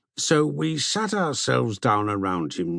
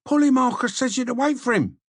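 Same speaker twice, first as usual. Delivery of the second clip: put-on high, fast, hurried messenger boy voice; eager young slave; 13 semitones higher, 3 dB louder, faster than usual. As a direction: blurt it out like a rushing servant. Slave delivers message breathlessly.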